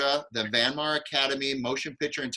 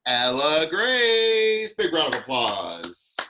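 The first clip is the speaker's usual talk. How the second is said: announcer call; 3 semitones higher, 5 dB louder, much slower than usual. Real, not acted. Announcing performer to group.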